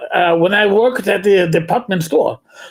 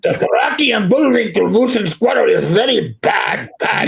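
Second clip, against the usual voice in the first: Russian-accented cartoon villain; hammy Russian accent; Boris Badenov; 2 semitones higher than usual. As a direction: pronounce judgement like a cartoon Russian spy. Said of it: "doing my Boris Bedinov".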